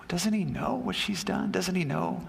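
hushed, high-pitched disbelief; indignant inner thought. Acted. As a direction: think it to yourself, scandalised and high. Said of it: "thinks Simon to himself".

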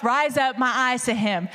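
usual speaking voice

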